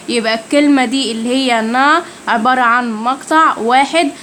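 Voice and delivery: ordinary talk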